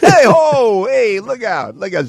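high, hammed-up Italian wiseguy "look out"; a joking Italian wiseguy warning about his shoes; mock tough-guy warning. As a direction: play a mock threatening paisan, high and playful.

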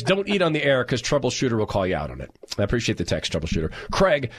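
ordinary talk

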